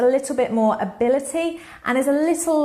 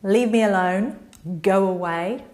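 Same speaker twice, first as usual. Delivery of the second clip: curt brush-off; 3 semitones lower than usual. Shown as a demonstration. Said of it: "don't say".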